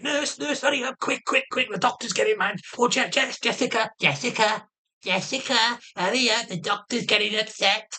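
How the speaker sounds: high, hammed-up frantic calling for the nurse; mock panic. Mocking the doctor by calling the nurse in fake alarm. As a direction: take the piss with fake urgency. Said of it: "So I just took the piss".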